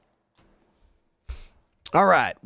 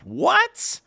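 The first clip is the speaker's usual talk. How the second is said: sharply higher, disbelieving question; surprised what. Real, not acted. Host reading a chat message, suddenly incredulous. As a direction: jump way up in disbelief.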